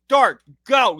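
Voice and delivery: retold scream. Fan yelling at the TV, retold scream. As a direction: yell it at the player on screen. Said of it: "I screamed at my T V".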